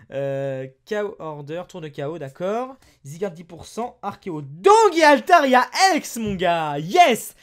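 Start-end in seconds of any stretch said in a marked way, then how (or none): 4.4-7.3 loud, drawn-out cry of triumph; excited shout. Real, not acted. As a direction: shout the card name out in real excitement. Pulls a rare card, celebrating.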